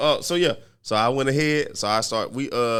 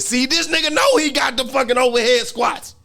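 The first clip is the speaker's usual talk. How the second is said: high, slow mocking voice, much higher and louder; mocking friend; 11 semitones higher, 5 dB louder than usual. Teasing his friend by imitating him, high mocking voice. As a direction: ham up the friend's cocky gloat. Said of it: "You said".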